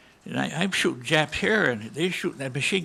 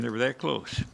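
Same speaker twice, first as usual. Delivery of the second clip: normal talking voice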